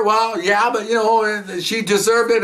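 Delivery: high-pitched defensive retort, retold; defensive husband. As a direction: imitate a husband justifying himself. Husband defends hitting his wife.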